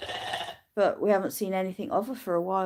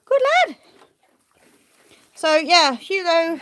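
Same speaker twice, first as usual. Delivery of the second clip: very high, louder praise; 9 semitones higher, 6 dB louder, slower than usual. Real, not acted. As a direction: cheer him on. Praising the sheep.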